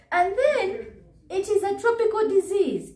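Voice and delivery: a sing-song, mocking high retort. Vlogger mocking the doctor's claim in a high put-on voice.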